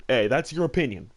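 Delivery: ordinary delivery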